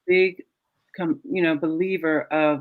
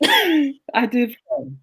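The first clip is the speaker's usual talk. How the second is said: slow admission; 5 semitones higher, much slower than usual. Retold or imitated. Husband finally admits.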